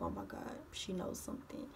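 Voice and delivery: dropped to a near-whisper, dreading it; scared. Retold or imitated. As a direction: retell a frightened thought almost under the breath. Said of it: "And I'm scared".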